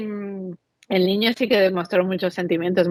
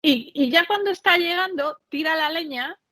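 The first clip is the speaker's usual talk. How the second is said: usual speaking voice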